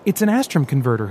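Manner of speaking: eager, tumbling enthusiasm; excited explanation. Acted. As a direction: show off the new toy. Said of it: "he explained excitedly".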